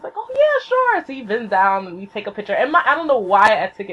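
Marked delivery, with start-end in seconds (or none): 0.2-1.0 very high, obliging reply; imitated friendly celebrity yes; breezy agreement. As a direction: agree readily in a sing-high voice. Voices idol agreeing readily.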